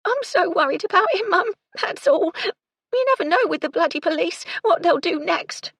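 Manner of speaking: very high, loud distressed wail; tearful, hiccupping. A narrator's reading. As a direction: smother sobs as you fret. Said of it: "Gertie said between hiccups".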